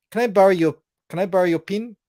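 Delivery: high, loud New Zealand vowels, "pin" for pen; thick New Zealand accent. Retold or imitated. New Zealand girl asking to borrow a pen, thick accent. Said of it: "had a really thick accent".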